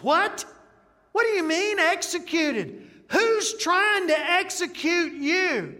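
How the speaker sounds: loud, high, outraged shout; king shouting at the betrayal; furious king. Acted. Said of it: "he's furious".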